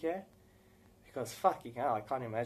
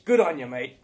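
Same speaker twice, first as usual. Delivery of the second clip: loud mock Irish accent, more English; Jacksepticeye; 12 dB louder than usual. Fan imitating a YouTuber's accent, loud mock Irish. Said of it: "He's got the Irish accent there".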